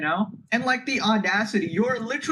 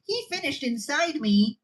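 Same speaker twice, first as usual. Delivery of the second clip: higher whiny mock accuser; 5 semitones higher than usual. Mocking an opportunist accuser's story in a higher voice. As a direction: parody a made-up victim's claim. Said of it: "They're trying to come out with their stories".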